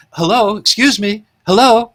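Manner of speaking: a lady calling sweetly from a window; imitating a lady's call. Lady Jane calls down from the window, high mock voice. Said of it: "she's talking to them".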